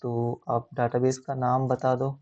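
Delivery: normal talking voice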